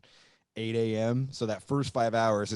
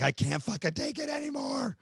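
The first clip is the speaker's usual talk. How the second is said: high, cracking whine; mock a roadie at breaking point; whiny breakdown. Green roadie cracking after five days on the bus, whining high.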